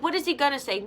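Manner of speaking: ordinary talk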